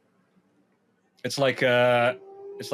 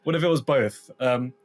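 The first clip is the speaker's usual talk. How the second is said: ordinary delivery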